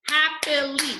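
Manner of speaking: "happily" high, as hap-pi-ly; syllable by syllable. Shown as a demonstration. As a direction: clap out each syllable of "happily". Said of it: "break it up by the vowel sound".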